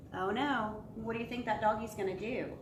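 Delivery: ordinary delivery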